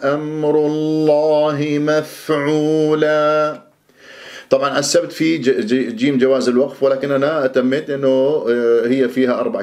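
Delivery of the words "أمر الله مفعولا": slow measured tajwid chant; Quran recitation. Shown as a demonstration. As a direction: recite the verse slowly with full madd. Recites the full verse.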